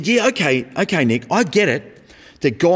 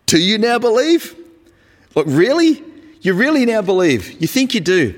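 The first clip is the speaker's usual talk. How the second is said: incredulous rhetorical question; 8 semitones higher than usual. Acted. Jesus doubting the disciples' sudden faith, high and incredulous. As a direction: challenge their sudden faith in disbelief. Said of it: "I think it was a rhetorical question".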